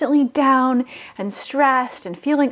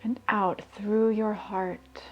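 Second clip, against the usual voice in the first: guided meditation; 9 dB quieter than usual. Acted. [name] guiding listeners to raise their vibration, soft meditation voice. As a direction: breathe the words out calmly, leaving long pauses.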